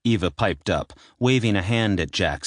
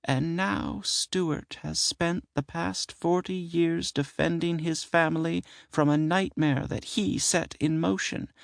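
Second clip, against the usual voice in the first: haunted tone; 12 semitones higher than usual. Acted. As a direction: recall an old nightmare, hushed. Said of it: "continuing in a haunted tone".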